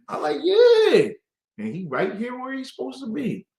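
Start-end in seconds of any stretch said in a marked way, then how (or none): 0.4-1.1 high, loud drawn-out yeah; emphatic yeah. Retold or imitated. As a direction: agree with full conviction. Retells emphatic agreement.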